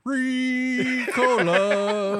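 Ricola yodel. Pretend. Climbers shouting from the mountainside, a stretched Ricola yodel. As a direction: sing out the brand name like the commercial. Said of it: "there's three guys going".

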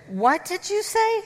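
high, startled disbelief; shocked. Retold or imitated. Wife hears the confession, shocked. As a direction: jump up in shock. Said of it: "And I said".

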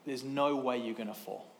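put-on low, soft reassurance to a scared child; reassuring dad. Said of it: "And I said to him".

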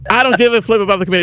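high, fast, loud boastful brag; cocky DJ voice. Pretend. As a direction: sneer it like a vain DJ. Repeats the line as a vain DJ.

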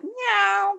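high playful meow; mock cat meow. Cat in joke meows.

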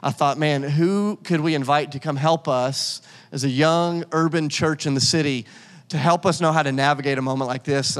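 ordinary delivery

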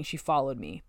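ordinary delivery

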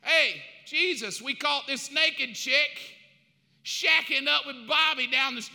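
loud, high call to Jesus; high mob shout at Jesus; shouting accuser. Acted. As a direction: yell it out like an angry mob leader. Pharisees calling out to Jesus.

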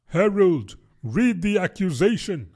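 a pompous little king; a touch of a royal command. Acted.

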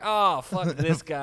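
instantly fed up, blurt it out loudly; exasperated outburst. Retold or imitated. Reacting to a new host's voice, loud exasperation.

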